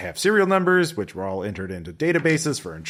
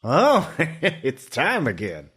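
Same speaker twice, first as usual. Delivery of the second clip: put-on slow, higher voice of an old prospector; 5 semitones higher, much slower than usual. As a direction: play the old prospector with a chuckle. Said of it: "I love it when our contact's an old prospector".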